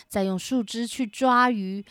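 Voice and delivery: usual speaking voice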